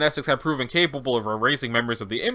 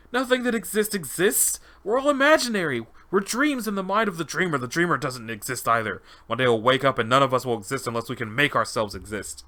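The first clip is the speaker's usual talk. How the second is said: raving test subject. Acted. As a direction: proclaim a mad revelation. Subject raves about the dreamer.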